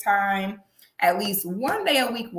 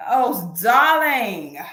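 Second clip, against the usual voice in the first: slow drawn purr; 5 dB louder, much slower than usual. Acted.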